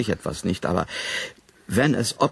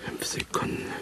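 whispered to himself; 11 dB quieter than usual. Acted. Repeats while waiting quietly.

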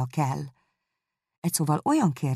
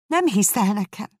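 raised, mildly hurt exclamation; exclaiming; 7 semitones higher, 4 dB louder than usual. A narrator's reading. Jane protesting to her doubting sister, exclaiming. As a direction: cry out in protest, a touch higher. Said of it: "kiáltott fel Jane".

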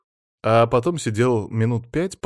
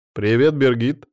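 loud, higher cheerful greeting; exclaiming; 4 semitones higher, 7 dB louder than usual. A narrator's reading. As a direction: greet an old friend with gusto. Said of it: "воскликнул я". (радостно восклицая)

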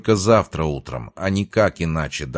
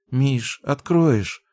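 slow, high, sleepy request; sleepy mumble; 9 semitones higher, much slower than usual. A narrator's reading. Girlfriend in bed asks boyfriend to open up, sleepy. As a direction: drag it out drowsily, pitched up. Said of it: "сонно пробормотала Даша".

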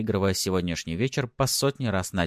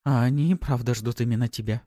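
higher, lightly ironic woman; 5 semitones higher than usual. A narrator's reading. Pafi teasing the narrator, ironic. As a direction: remark it with a touch of irony. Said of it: "иронично заметила Пафи".

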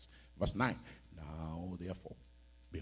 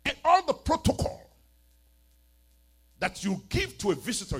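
loud rebuke; 11 semitones higher, 13 dB louder than usual. Acted. Jesus rebukes Simon, preached.

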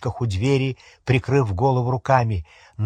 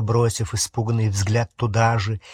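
ordinary delivery, nothing marked

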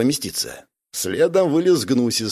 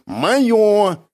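high, loud, possessive claim; categorical; 9 semitones higher, 5 dB louder than usual. A narrator's reading. Бяка claiming the mounts from Гнусис, categorical. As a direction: state it allowing no argument. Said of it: "безапелляционно ответил Бяка".

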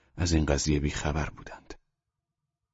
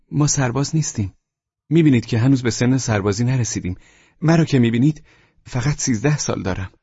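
1 startled plea; 6 semitones higher, 5 dB louder than usual. A narrator's reading. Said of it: "در حالی که جا خورده بودم".

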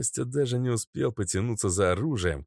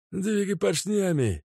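barked thug order; 12 semitones higher than usual. Acted. Mazut pushes Kostya.

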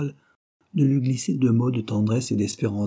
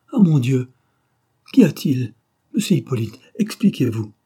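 raised, louder cry of dismay; alarmed exclamation; 3 semitones higher, 6 dB louder than usual. A narrator's reading. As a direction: exclaim on rushing in, then demand an explanation. Wife runs in, alarmed at the sight.

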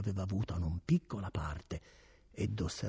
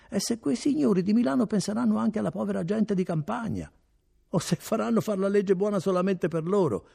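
higher, louder, resentful peasant; resentful villager; 12 semitones higher, 8 dB louder than usual. A narrator's reading. Villager complaining about Milan's gentlemen, higher and louder. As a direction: rail against the proud townsfolk.

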